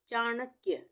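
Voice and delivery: ordinary delivery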